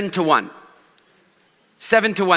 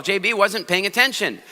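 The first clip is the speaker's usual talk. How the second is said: normal talking voice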